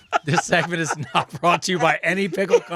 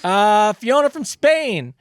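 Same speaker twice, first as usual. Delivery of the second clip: announcer intro; 4 semitones higher, 5 dB louder, much slower than usual. Acted. Reads out listener letter.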